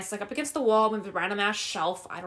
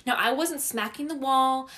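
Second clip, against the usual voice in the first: ordinary talk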